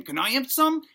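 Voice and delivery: high-pitched begging puppy voice; pleading puppy. Acted. Puppy begging the family for turkey, high pleading voice. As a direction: ask hopefully as a little pup. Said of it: "The puppy's looking up at them saying".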